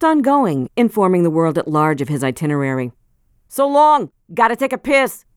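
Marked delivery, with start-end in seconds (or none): 3.5-5.2 high, loud, carefree call-out; loud announcement. A narrator's reading. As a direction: blurt it out loudly, careless. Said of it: "he suddenly announced".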